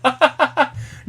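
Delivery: high, staccato burst of laughing; high laughter. Real, not acted. Reacts to the parrot question.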